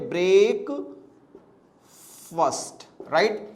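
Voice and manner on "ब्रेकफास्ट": ब्रेकफास्ट in two separated parts, second vowel short; dictionary pronunciation. Shown as a demonstration. Teacher reading out the dictionary's sound symbols, careful pronunciation. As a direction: sound out ब्रेकफास्ट piece by piece, ending "fust". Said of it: "लिखा रहेगा इस प्रकार से".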